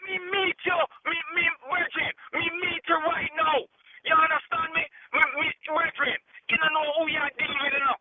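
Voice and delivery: heated Jamaican patois, high and pushing; a Jamaican man squaring up on the phone; real Jamaican anger; angry Jamaican patois. Real, not acted. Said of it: "you went on a Jamaican run".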